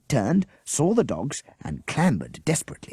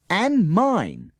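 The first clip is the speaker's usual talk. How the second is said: And mine, called high and loud, falling; the whole class yells And mine together; shouted; 10 semitones higher, 5 dB louder than usual. Acted. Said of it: "the rest of the class shouted".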